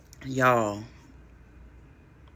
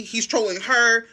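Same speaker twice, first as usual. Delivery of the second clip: ordinary talk, nothing marked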